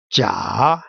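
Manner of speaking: Jia dipping low, then rising; low scooped Jia with an upturned end; third tone. Shown as a demonstration. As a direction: take Jia down deep and bring it back up. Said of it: "let me read it".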